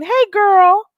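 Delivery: put-on high, gushing "hey girl" chatter; fake girlfriend voice. Mocking fake friends who act close, gushing high. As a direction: play the phony bestie, overly sweet. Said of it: "stop lying".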